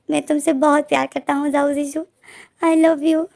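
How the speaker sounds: mock wail of love, put on to tease; fake crying. Wen Keqing fakes tears for his lover, hammed-up mock crying. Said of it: "झूठी मूठी का रोने लग जाता है".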